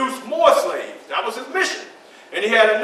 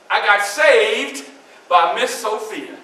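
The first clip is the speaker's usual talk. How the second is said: normal talking voice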